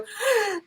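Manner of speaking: sharp high gasp in fright; tiny startled intake of breath. Retold or imitated. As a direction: gasp high and sudden like a scared child. Girl scared by own laugh.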